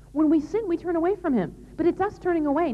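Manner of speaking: usual speaking voice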